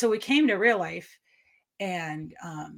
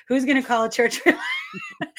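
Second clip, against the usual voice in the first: laughing disbelief; 8 semitones higher, 6 dB louder than usual. Retold or imitated. Retold thought, laughing incredulity.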